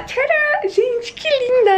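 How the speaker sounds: squealed high and drawn out, a tearful thrill; gushing over a new top; excited reveal. Real, not acted. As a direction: unveil it like a prize, gushing. Showing off new blouse.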